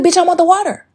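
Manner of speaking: high, loud, sassy demand. Retold or imitated. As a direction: snap back like the answer is obvious. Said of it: "You can be like".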